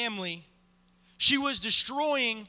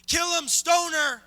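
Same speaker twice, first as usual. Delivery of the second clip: slow, louder demand for death, higher; crowd yelling; 3 semitones higher, 3 dB louder, slower than usual. Acted. Preacher voicing the crowd demanding the stoning, slow angry yell. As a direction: chant it like a mob calling for stoning.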